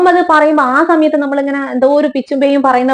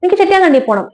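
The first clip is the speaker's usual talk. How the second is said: എനിക്ക് ചെട്ടിയാങ്കണ്ടി പോണം pitched much higher, pleading and stubborn; insistent grandmother; 8 semitones higher, faster than usual. Retold or imitated. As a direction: grandmother begging to be taken home, higher. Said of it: "അതുവരെ ബഹുമാനത്തോടെ സംസാരിച്ച അമ്മമ്മ". (വാശിയോടെ ഉയർന്ന സ്വരത്തിൽ)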